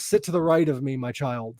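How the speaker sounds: low, soft sinister devil. Retold or imitated. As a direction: hush it like a calm Satan. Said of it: "yes, I do impersonations".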